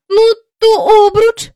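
put-on high, loud child's offer; anxious little girl bargaining; pleading girl. Jane offers another thing, pleading.

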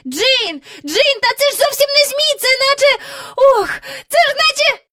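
very high, loud, stammering amazement; excited shout. A narrator's reading. Michael calling Jane about what is no kite, shouting. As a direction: cry out as realisation dawns. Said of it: "Раптом Майкл закричав".

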